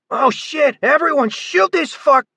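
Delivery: high, loud panicked shout; terrified guard shrieking at the others; panicked yell. A narrator's reading. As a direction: scream for everyone to fire. Rifleman panics at the monster.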